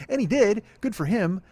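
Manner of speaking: sarcastic praise. Real, not acted. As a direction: sneer out a grudging compliment. Sarcastic exclamation.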